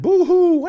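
high, loud hammed-up boo-hoo wail; mock baby crying. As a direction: wail like a fussy infant, overdone. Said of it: "you're just like".

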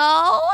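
long, very high cackle; ugly witch cackle. A narrator's reading. Witch laughs at the captive's hope, an ugly high cackle. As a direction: cackle like you've swallowed a crow. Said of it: "an ugly cackle that sounded like she'd swallowed a crow".

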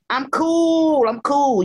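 high, drawn-out "cool"; playful "kewl". Real, not acted. Actress greeting her podcast hosts, playful high, long "cool". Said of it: "that's spelled K E W L".

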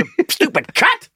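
high squawk breaking into laughter; mock bird cursing; swearing parrot. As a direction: screech the insult like a parrot. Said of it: "These were proper expletives".

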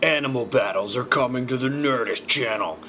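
hyped announcer plugging a show; big mock-dramatic ad read; intense commercial voice. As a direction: put on an intense promo voice. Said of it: "sound like my intense commercial voice".

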